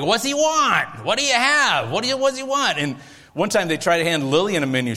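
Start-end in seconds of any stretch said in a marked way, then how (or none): 0.0-2.8 deli counter yell. Retold or imitated. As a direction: bark it like a busy deli guy. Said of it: "they yell something like".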